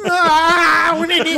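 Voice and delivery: excited teasing. Real, not acted. Teasing friend as baby.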